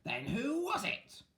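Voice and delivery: high, slow witch cry; witches crying out. Acted. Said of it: "the three witches cried".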